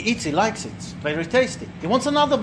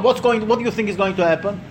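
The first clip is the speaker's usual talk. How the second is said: ordinary talk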